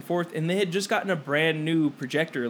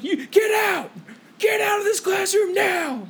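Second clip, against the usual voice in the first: high-pitched mock shriek; imitated teacher outburst; 3 dB louder, slower than usual. Friend imitating the tearful teacher ordering students out, high hysterical shout. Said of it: "she's like".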